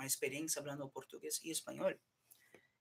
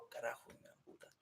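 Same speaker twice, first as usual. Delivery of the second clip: muttered curse; 7 dB quieter than usual. Real, not acted.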